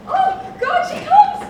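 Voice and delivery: rising excited cry; thrilled she finally arrives. Acted. Juliet sees nurse arrive.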